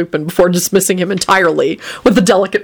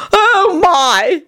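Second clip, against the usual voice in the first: vampire moan; 12 semitones higher than usual. A narrator's reading. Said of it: "he moaned".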